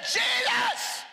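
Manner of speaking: piercingly high drawn-out shout; full-voiced high cry for attention. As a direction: yell it over the hecklers. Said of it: "He got louder".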